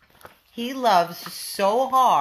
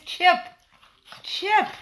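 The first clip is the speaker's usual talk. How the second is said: high loud call to the dog; 10 semitones higher, 3 dB louder than usual. Real, not acted. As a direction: call the dog's name sharply. Calling the dog.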